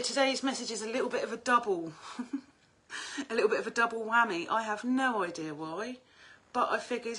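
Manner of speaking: usual speaking voice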